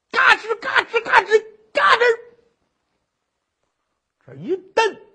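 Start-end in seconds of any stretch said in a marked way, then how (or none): 0.1-2.0 high, loud imitated creak-creak; 模仿嘎吱声; creaking wood noise. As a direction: mimic the squeezing boards. Imitated creaking torture boards.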